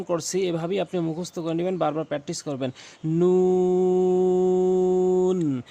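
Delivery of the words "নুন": নুন held very long, louder, lips rounded; stretched nun. Shown as a demonstration. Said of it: "টান টেনে পড়বেন এবং মুখটি গোলাকার". (মুখ গোল করে টেনে নুন)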